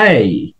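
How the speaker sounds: এই said low and soft as a gliding e-i; correct letter A. Shown as a demonstration. Teacher repeats the letter A for students, low and soft. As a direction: glide এই, not a flat এ. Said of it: "এ letter হিসাবে উচ্চারণ এ না".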